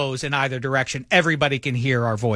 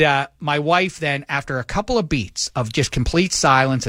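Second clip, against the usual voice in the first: usual speaking voice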